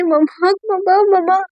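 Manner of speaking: put-on high, slow small-child wail; child calling mama. Little Raha calls for her mother, a small child's wail. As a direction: cry out for mama like a little girl.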